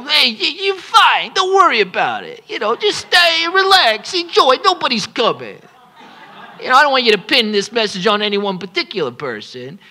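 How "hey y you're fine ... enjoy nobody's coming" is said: high, airy mocking voice with a laugh; mock carefree voice. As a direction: brush off the danger in a lilting high voice. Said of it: "maybe they're saying it like this".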